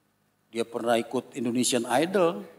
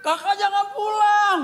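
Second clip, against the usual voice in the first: put-on much higher, louder little child begging; pleading child; 6 dB louder than usual. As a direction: beg like a Sunday-school kid. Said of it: "Anak sekolah minggu ada yang".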